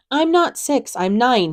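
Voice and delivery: put-on high, loud child protest; insist, voice jumping up high. A narrator's reading. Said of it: "he protested".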